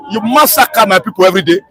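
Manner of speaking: normal talking voice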